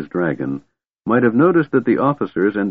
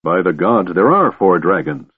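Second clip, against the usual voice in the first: ordinary delivery, nothing marked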